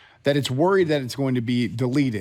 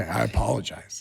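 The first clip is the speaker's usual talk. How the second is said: whispered apology; 5 semitones lower, 5 dB quieter than usual. Real, not acted. Mock apology after a correction.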